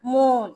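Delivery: মন with a drawn-out double-o, as in moon; long oo in মন. Shown as a demonstration. Teacher shows the class the double o, lengthened vowel in মন. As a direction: stretch the vowel of মন. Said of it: "lengthy হবে".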